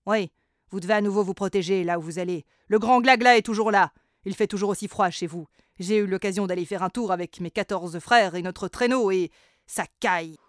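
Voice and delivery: loud, quick rabbit voice. Acted. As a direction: warn them like a fussy rabbit.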